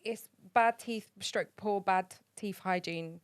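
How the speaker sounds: usual speaking voice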